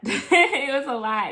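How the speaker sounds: slow, laughing repeat. Real, not acted. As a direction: shake your head through a laugh.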